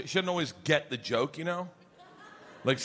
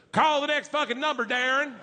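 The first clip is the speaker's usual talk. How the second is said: higher, louder barked order; commanding yell; 9 semitones higher, 4 dB louder than usual. Retold or imitated. Wife orders the emcee to keep going, loud and sharp. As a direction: snap the order at the stage. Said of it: "looks at the stage and goes".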